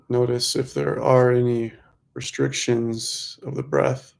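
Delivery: measured mantra pacing, leaving room to breathe; guided meditation. Real, not acted. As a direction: lead them gently through the breath, stretching key words.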